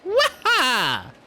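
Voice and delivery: high, loud cartoon whoop; Mario. Streamer imitating a game hero, high exuberant whoop. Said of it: "Or Mario. Mario works too".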